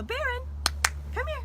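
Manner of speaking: high calling voice to the dog. Real, not acted. Librarian calling her dog Baron to come. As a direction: call him over, bright and high.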